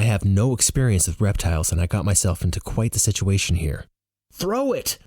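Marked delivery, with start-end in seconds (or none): 4.3-5.0 high-pitched command, THROW pushed hard; shouted order to get rid of it; urgent high call; yelling. Acted. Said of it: "Roar yells".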